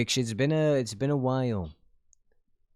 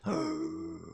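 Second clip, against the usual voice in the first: sudden high startled gasp; quick intake of breath, very high; sharp gasp; 6 dB quieter than usual. Real, not acted. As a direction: gasp in real shock. Release date is this week.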